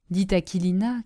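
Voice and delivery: usual speaking voice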